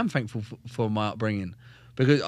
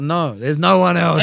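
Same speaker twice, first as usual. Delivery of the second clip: louder, slightly slow, laughing insistence; proud retold reply; 4 semitones higher, 9 dB louder than usual. Young soldier telling corporals it was him, loud and proud. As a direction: land it loud and pleased with yourself. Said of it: "I was like".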